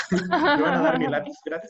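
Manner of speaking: words breaking into laughter; laughing. Retold or imitated. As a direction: giggle out a cheeky request. Mocking students asking for pencils.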